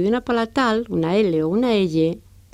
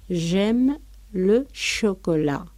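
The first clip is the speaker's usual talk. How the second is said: J'aime le chocolat muy despacio y claro; slow French example; much slower than usual. Shown as a demonstration. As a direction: dicta la frase francesa lentamente. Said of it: "utilizan el verbo aimer". (ejemplo francés dictado despacio)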